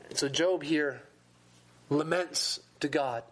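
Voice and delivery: normal talking voice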